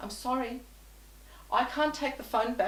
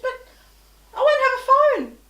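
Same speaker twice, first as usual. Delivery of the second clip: shocked protest; 14 dB louder than usual. Retold or imitated.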